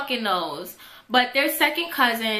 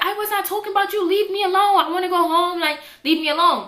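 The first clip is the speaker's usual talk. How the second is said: high pleading protest; 7 semitones higher, faster than usual. Retold or imitated. Girl begging the bully to let her go home, high and pleading.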